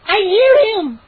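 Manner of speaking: put-on high, loud girl's voice; excited younger sister. A narrator's reading. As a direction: blurt it out thrilled. Mindy excited.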